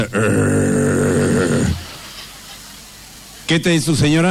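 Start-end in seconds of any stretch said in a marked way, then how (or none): 0.0-1.7 low growling arr; dog growl. Retold or imitated. Dog interrupts the couple with a low growl.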